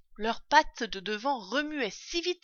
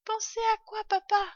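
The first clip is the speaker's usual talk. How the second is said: put-on very high child's voice; little fox cub; 13 semitones higher, 5 dB louder than usual. A narrator's reading. Cub asks father about his idea, high childlike voice. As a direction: ask like a curious small child.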